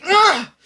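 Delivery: very high, loud hammed-up yell. Acting out the hero's feeling, high loud mock scream. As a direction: belt out a cartoon scream. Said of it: "it's real like".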